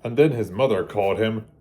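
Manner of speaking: storybook read-aloud, gentle and lively; children's storybook narration. A narrator's reading. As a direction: read it like bedtime story time for kids. Reading picture book to children.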